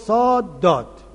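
«صاد داد» with the second word a plain d, «داد», slow; zad said as dal. Retold or imitated. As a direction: imitate the sheikh failing the zad in «صاد داد». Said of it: "گفت". (تقلید شیخ، «صاد داد» با دال به جای ضاد، آرام)

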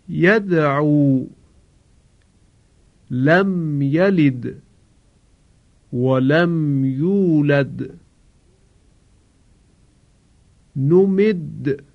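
slow tajwid, the د of لم یلد and ولم یولد bounced; recitation with qalqala. Shown as a demonstration. Teacher models the dal examples for the class. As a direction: chant slowly, echoing each dal. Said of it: "آخرین حرفی که دارای قلقله هست حرف دال هست".

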